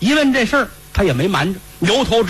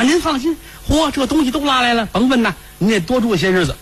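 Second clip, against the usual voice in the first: high-pitched obliging servant; eager grave keeper; 8 semitones higher than usual. Acted. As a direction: pitch it up and fuss over the guests. Keeper eagerly reassures master.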